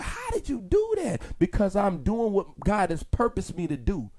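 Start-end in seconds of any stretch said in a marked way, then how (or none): 0.0-1.2 high, soft voice of amazement; imitating amazed people, pitched way up; astonished onlookers. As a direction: ask it high-pitched, in hushed disbelief. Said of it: "And they be like".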